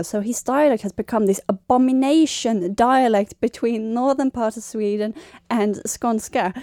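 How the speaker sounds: usual speaking voice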